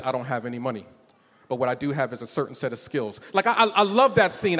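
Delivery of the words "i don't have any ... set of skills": lower, softer movie-hero line; lightly voiced tough guy threat; hint of a calm action-film menace; Liam Neeson impression. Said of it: "The part where he says".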